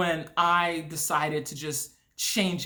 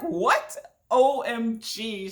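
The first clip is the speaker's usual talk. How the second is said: high, drawn-out disbelief; retold shock; 4 semitones higher, 3 dB louder, much slower than usual. Sister hearing about the jump, shocked. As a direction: imitate a stunned sister, pitch jumping up.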